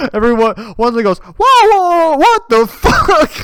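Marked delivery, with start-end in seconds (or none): 1.2-3.3 high, very loud imitated shout; mock outraged yell. Woman's outraged reaction, acted.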